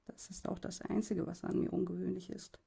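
soft, downcast murmur; dejected murmur. Acted. Bullied boy mutters about himself, soft and dejected. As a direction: mumble it, beaten down. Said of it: "murmle ich und niedergeschlagen".

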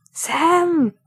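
put-on loud, high call for his child; frantic call. A narrator's reading. Mumm in the water calling for his son. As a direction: call out desperately.

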